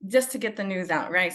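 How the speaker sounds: normal talking voice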